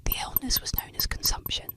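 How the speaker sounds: usual speaking voice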